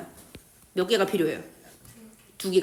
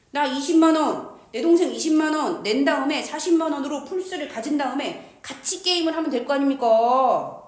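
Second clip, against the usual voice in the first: loud, higher-pitched pitch of a plan; emphatic proposal; 3 semitones higher, 4 dB louder than usual. Acted. Teacher acting out the deal to share a console, emphatic and loud. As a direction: declare the bargain out loud.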